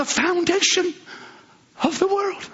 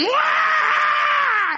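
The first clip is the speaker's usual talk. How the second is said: shouted wah; 13 semitones higher than usual. Acted. Seed bursting to life.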